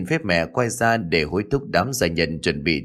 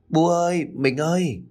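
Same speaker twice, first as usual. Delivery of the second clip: higher voice calling across the water; 7 semitones higher than usual. A narrator's reading. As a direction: call out to them from the boat. Girl calls from the boat.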